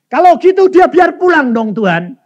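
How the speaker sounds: loud, high bargaining; retold pleading with God, pitched high; insistent plea. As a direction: push the request eagerly at full voice.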